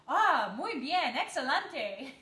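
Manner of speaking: slow, loud, swooping Spanish exclamation; hammed-up, sing-song admiration in Spanish; expressive child exclaiming. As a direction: imitate a kid's theatrical delight. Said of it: "she has such an expressive face".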